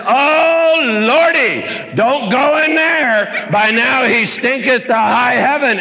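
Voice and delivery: high alarmed Martha pleading; hammed-up fussing sister. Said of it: "And she said".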